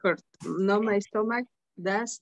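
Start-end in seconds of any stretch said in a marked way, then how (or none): none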